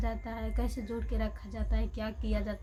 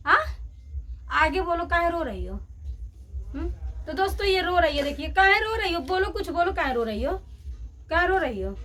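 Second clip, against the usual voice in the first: loud coaxing; 5 semitones higher, 5 dB louder than usual. Real, not acted. Mother asks crying baby daughter why she cries, loud sing-song coaxing.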